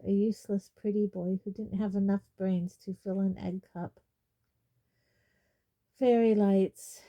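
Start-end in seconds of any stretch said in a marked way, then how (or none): none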